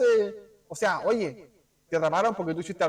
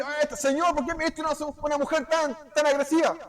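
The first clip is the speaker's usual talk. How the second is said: reclamo agudo de marido quejoso; queja burlona; 7 semitones higher than usual. Retold or imitated. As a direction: imitando a un marido que culpa a Dios. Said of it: "yo no puedo decir". (marido quejándose a Dios, en tono agudo de burla)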